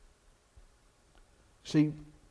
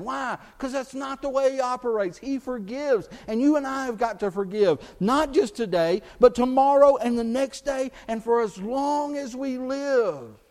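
higher, louder, driving sermon delivery; preaching at full force; 7 semitones higher, 6 dB louder than usual. Real, not acted. Pastor urging the church to keep forgiving, full preaching force. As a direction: press it home to the congregation.

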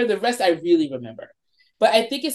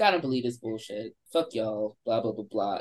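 lower, softer scoffing guy; dismissive skeptic; 9 semitones lower, 7 dB quieter than usual. Retold or imitated. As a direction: brush them off like a cocky older guy. Skeptical older DJ voiced.

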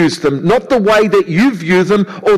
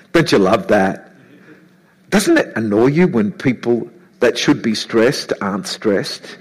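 usual speaking voice

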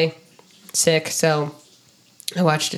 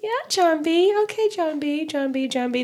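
high, fading repeated name; mock high girl voice; 9 semitones higher than usual. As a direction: parody a girl saying his name. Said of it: "She's like".

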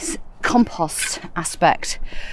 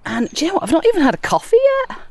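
excited; 10 semitones higher, much faster than usual. Real, not acted. Excited aside about morning energy.

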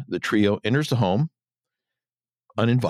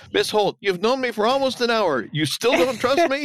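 high-pitched mock indignation; offended; 12 semitones higher than usual. Bullets answers Laura's warning, hammed-up offended protest. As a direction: play wounded disbelief, pitch climbing to the question. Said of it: "Bullets is offended".

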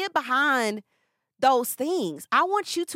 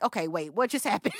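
hushed, lower, dazed "wait, what?"; bewildered aside; 5 semitones lower, 3 dB quieter than usual. Retold or imitated. Retelling her first speaking gigs, a soft bewildered murmur. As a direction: play it stunned, half to yourself. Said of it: "And then I would sit down like".

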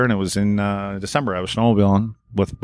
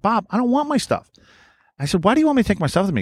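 exasperated pitch up; 10 semitones higher, faster than usual. Retold or imitated. Exasperated retold question.

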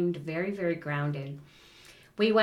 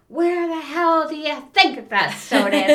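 much higher, much louder, fast mock snap; angry yelling parent; 9 semitones higher, 10 dB louder than usual. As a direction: bark it out like a fed-up Italian mom in mid-fight. Parent yelling in kitchen battle.

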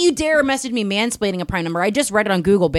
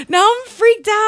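mock freaked out; 11 semitones higher than usual. Real, not acted. Laughing mock fright.

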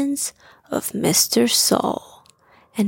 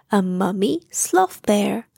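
ordinary delivery, nothing marked

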